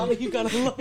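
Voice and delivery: words broken by laughter; laughing. Real, not acted. Laughing mid line.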